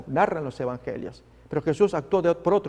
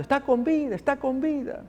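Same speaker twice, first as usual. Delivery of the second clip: much higher, excited cries; joyful shouting; 10 semitones higher than usual. Acted. Relatives crying out that the girl lives, excited. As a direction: shout the good news with joy. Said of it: "y todos gritando".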